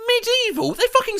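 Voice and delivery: high, disbelieving squeak; can't believe what you just read; incredulous. Real, not acted. Disbelief at the context.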